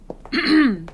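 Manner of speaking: loud, embarrassed throat clearing. Acted. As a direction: clear the throat hard to regain composure. Regaining composure.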